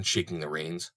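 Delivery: ordinary delivery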